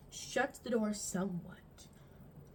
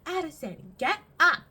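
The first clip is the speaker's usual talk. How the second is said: louder, sharp call to wake; imitating mom yelling to get up; mom's loud call; 5 semitones higher, 10 dB louder than usual. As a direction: bark the name up the stairs. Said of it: "she'd get progressively get worse".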